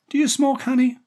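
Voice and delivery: very high, loud woman's question; friendly woman. A narrator's reading. Daphne offering gum to the boy, friendly woman.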